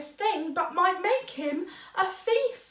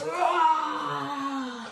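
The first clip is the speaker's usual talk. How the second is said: a big drawn-out roar; loud roar. A narrator's reading. Narrator booming roar to show the lion. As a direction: let the word roar out. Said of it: "how loud he could".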